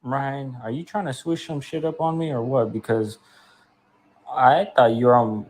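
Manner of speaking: ordinary talk, nothing marked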